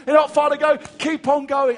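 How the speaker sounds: high, loud calls of encouragement; crowd shouting. Retold or imitated. Crowd at the marathon cheering John on, loud and high. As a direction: yell it like spectators at the roadside. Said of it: "voices of people shouting out".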